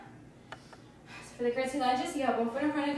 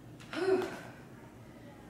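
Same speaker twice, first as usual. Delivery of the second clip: tired, breathy sigh after the set; heavy exhale; 6 semitones higher, 6 dB quieter than usual. Real, not acted. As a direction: blow out air after effort. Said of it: "I'm really feeling this in my butt".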